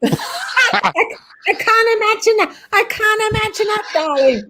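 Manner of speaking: high-pitched, laughing protest; amused disbelief. Real, not acted. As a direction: squeal it through real laughter. Laughing at friend's joke about not returning.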